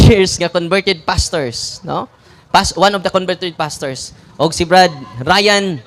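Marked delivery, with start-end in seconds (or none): none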